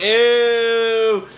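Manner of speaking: high drawn-out "ew"; mock crowd disgust. Mocking the crowd's reaction to the kiss. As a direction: pull a face. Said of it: "the crowd is like".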